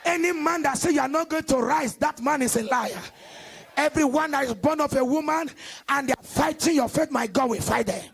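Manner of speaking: loud call and response sermon; fiery preaching. Acted. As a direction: hammer each line to the congregation. Preaching at full force.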